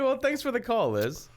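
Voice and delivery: high-pitched teasing copy of the sign-off; mocking the coach. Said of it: "Don't make fun of my coach".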